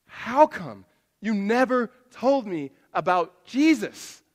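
loud, slow, incredulous demand; confronting an old friend, loud and slow; indignant question. Retold or imitated. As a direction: drag it out in disbelief. Confronting friend about Jesus.

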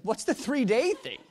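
high, loud puzzled question; retold bewilderment, pitched well up; baffled. Preacher asking the woman about her method, baffled.

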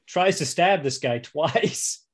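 ordinary talk, nothing marked

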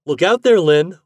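put-on high, loud boyish warning; warning call. A narrator's reading. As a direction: call out a quick caution. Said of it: "warned Fat".

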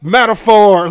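loud high mocking yell; mocking shout. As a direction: shout it like an obvious dunk.

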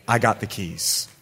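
low, quiet statement as God; understated divine assurance. Acted. God reassuring a believer about an opened door, low and understated. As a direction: say it low and softly, letting it sink. Preacher voicing God, first take.

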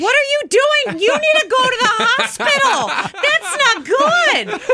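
high, alarmed, half-laughing panic; a real laugh in the mock panic; freaking out. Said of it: "I was the only one there freaking out".

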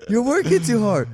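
put-on high, loud tipsy girl voice; drunk girl voice. He mimics the drunk girl calling to him, high and loud. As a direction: call down like a tipsy fan.

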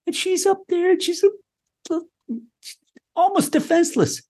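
high, thin-pitched pity for the princess; helpless. Retold or imitated. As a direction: make her sound small and defenseless.

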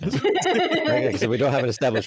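joking back at the hosts, laughing. Real, not acted. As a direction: drag it out through laughter. Teasing callback, laughing.

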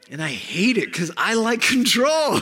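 loud laughing outburst; laughing shout. Real, not acted. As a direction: blurt it out laughing. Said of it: "feeling the little jitters".

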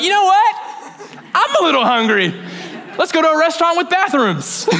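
high, laughing, eager to jump on the chance; eager relief. Acted. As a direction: seize the excuse with a laugh and a high voice. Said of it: "You're like".

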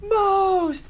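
high, stretched-out theatrical yell; hammed-up cry at a very high pitch; imitated stage yell. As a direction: wail it out like a tormented stage ghost. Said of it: "He's yelling at the top of his lungs".

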